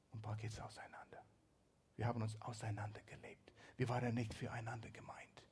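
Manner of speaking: whispered, as if confiding a secret; hushed whisper. Acted. Preacher voicing couples who drifted apart, in a whisper. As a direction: drop to a whisper of quiet regret.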